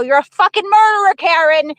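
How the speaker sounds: imitating an angry parting shot; retold accusation. Retells hurling accusation at Karen.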